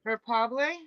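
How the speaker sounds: Hyperbole as "her-POB-lay", questioning; corrected HYPERBOLE. Real, not acted. Viewer offering a correction, Hyperbole ending in "-POB-lay". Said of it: "I think it's pronounced differently".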